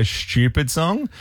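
"stupid song" in an Australian accent, the u in "stupid" pushed forward. Shown as a demonstration. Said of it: "In the Australian accent, we say".

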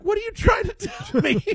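high, laughing disbelief; incredulous. Retold or imitated. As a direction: act baffled and half laughing at him. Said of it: "I'm looking at him like".

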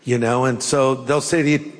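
normal talking voice

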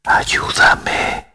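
ghostly breathy whisper; eerie whisper. A narrator's reading. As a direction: breathe it like a plea from beyond. Word appearing on girl's skin.

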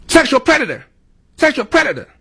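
very high, put-on cartoonish cry; mock yelling out. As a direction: squawk it out as a mocking imitation. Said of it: "yell out".

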